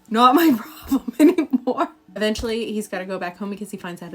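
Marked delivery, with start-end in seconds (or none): 0.1-1.9 breezy brush-off through a laugh; laughing shrug. Retold or imitated. Scientist shrugs off the missing monster, laughing. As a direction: shrug it off with a giggle. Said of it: "He's just like".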